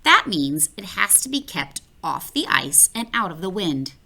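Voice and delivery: ordinary delivery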